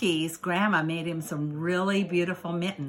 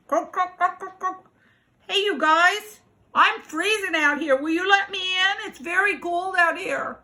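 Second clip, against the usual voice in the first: high croaks, then pleading frog; 10 semitones higher than usual. Acted. Freezing frog asking to come in, high and pleading. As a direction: call out as an eager frog, pitched high.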